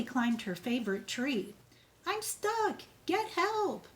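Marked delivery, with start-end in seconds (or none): 2.1-3.9 put-on high, panicky child's cry; stuck and scared. Tilly stuck up her favorite tree, calling for help, high and panicked. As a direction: call down from the tree, frightened.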